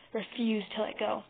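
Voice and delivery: ordinary delivery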